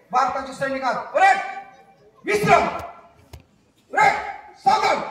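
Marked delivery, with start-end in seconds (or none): 1.1-2.6 short, sharp and loud, high up; parade-ground shout; barked drill command. Acted. Drill commands to hypnotised crowd. 3.9-5.0 short, sharp and loud, high up; parade-ground shout; barked drill command. Acted. Drill commands to hypnotised crowd.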